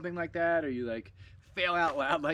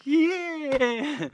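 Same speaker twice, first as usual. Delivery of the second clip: much higher and louder, a sudden gleeful shout; playful jump-scare yell; 14 semitones higher, 10 dB louder than usual. Retold or imitated. Jumping out at his partner on the trail, loud gleeful yell. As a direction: leap out and yell to spook her. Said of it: "and I'm like".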